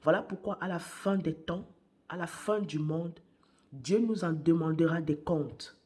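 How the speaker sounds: ordinary talk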